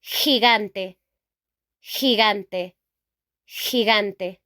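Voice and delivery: "gigante" with a throaty h, then hard g; Spanish pronunciation. Shown as a demonstration. Teacher showing learners Spanish for giant, "gigante" as hee-GAHN-teh. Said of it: "In Spanish".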